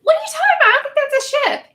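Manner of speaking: high, incredulous squeak; what ship, in mock confusion; retold disbelief. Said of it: "I'm like".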